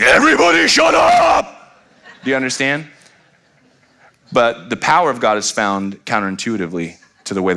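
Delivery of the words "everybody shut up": high, drawn-out exasperated shout; full-volume parental bellow, pitch shooting way up; yelling dad. Acted. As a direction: yell over a roomful of noisy kids. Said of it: "bring your voice to the top".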